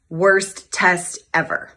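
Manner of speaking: slow, heavy, word by word; grim title. Retold or imitated. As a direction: spell out the grim title slowly.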